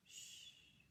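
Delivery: soft unvoiced shh; hushing whisper. A narrator's reading. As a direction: hush him gently. Mother hushing crying child.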